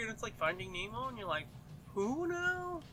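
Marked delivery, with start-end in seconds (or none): 1.9-2.8 high, baffled mock question; clueless. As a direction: play dumb, pitched way up. Imitating friend's confusion.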